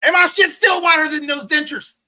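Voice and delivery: high, loud bragging jab; loud, high taunting brag; mocking shout. Real, not acted. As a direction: crow it loudly at him.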